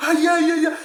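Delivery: high, loud mock cries; imitated excited man. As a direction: holler like a stranger rushing in to hug. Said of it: "Un monsieur comme ça". (cris imités d'un inconnu, aigus et très forts)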